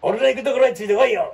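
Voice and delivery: mock heroic call; cool hero line. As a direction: call out to the followers like a leading man. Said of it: "かっこええやん".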